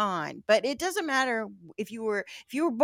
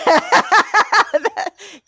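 high loud laugh; 10 semitones higher, 13 dB louder than usual. Real, not acted. Host cracking up at the horse girl joke, loud high laugh.

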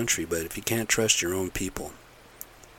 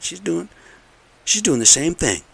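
indignant outburst; 10 semitones higher, 7 dB louder than usual. Real, not acted. Angry complaint about cousin.

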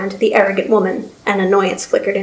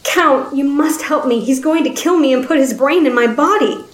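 high, frightened begging; wounded man pleading for his life; desperate pleading; 5 semitones higher than usual. Acted. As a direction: beg the Count to save you. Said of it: "as he pleaded".

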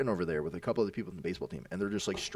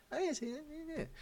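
put-on much higher, softer kid voice; meek denial; 10 dB quieter, slower than usual. Bully caught out by fifth grader, meek high denial. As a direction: shrink back and deny it timidly. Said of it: "he goes".

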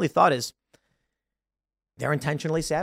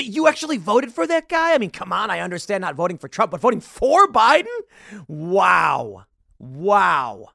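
mock disbelief; 4 semitones higher, 4 dB louder than usual.

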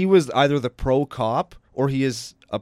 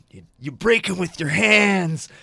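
wrestling promo; 6 semitones higher, 4 dB louder, slower than usual. Retold or imitated. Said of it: "that was the best promo I've ever heard".

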